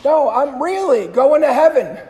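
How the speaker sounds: loud, high insistent call; called again. Retold or imitated. Priest calling out his answer a second time, loudly. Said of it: "He called again".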